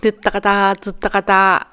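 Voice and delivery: playful sing-song tsuttakata; mock marching sounds. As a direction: chant it like little footsteps. Mimicking walking rhythm.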